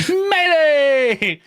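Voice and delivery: laughing high shout. Real, not acted. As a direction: yell it out with a laugh.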